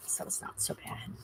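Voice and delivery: unvoiced whispered aside; hushed reassurance. Real, not acted. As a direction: whisper it like a confession. Aside about sugar.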